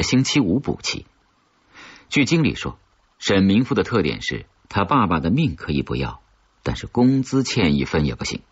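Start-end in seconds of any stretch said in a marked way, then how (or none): none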